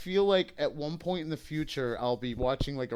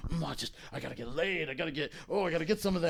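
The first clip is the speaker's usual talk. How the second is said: lustful guy voice; 2 semitones lower, 7 dB quieter, faster than usual. Retold or imitated. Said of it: "guys around me that'd be like".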